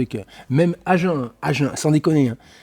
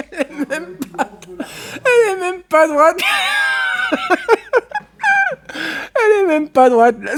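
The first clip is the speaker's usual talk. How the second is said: laughing hard; 5 dB louder than usual. Real, not acted. Laughing at crooked target.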